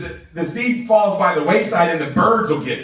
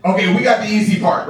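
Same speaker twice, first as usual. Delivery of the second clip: usual speaking voice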